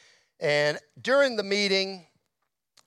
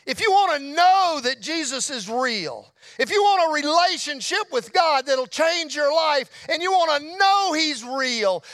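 raised, emphatic pulpit voice; preacher's altar call; 5 semitones higher than usual. Retold or imitated. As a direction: imitate a pastor urgently summoning the congregation. Pastor's altar call, retold.

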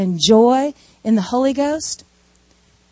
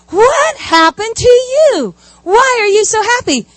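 amazed coworkers; 9 semitones higher than usual. Acted. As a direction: act stunned at his cheer. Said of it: "They're going".

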